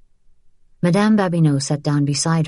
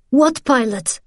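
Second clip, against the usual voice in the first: higher-pitched, frightened question; gasped denial; 8 semitones higher than usual. A narrator's reading. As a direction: gasp it in alarm. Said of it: "she gasped".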